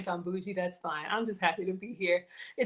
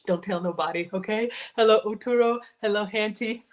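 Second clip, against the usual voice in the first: usual speaking voice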